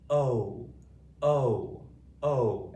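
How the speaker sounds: long open O, held and clear; long O modelled. Shown as a demonstration. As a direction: model the long O loudly for the class. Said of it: "Everybody say O at home".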